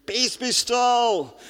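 imitating a hammed-up film Jesus, high and slow; dragged-out theatrical mock command; mock movie shout. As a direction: ham it up like a screen Jesus yelling at the waves. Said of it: "Jesus sort of shouting at the".

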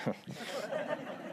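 quiet breathy chuckle; soft laugh. Real, not acted. Preacher laughing after offering to hand over the sermon, soft chuckle. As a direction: laugh softly at your own joke.

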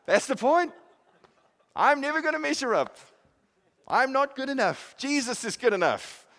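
high, loud cry easing down into praise; exultant preaching. Real, not acted. Preacher declaring to the congregation that Jesus is good enough, exultant. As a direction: proclaim it at a high pitch, then settle gradually.

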